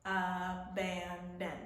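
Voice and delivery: abandon slowly split into a-ban-don; syllable by syllable. Shown as a demonstration. Teacher counting chin drops, abandon said in slow separate beats. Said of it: "How many times did my chin go down?".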